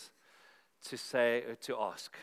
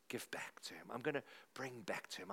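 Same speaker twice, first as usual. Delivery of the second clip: softer, whispered vow; 3 semitones lower, 9 dB quieter than usual. Acted. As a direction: drop to a whisper of resolve. Earnest resolve in sermon.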